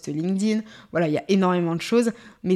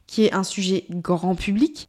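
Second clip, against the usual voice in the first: ordinary talk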